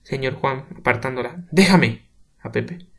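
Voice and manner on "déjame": higher, louder brush-off; shouted rebuff. Acted. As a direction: shove her off with words. Pushing her away.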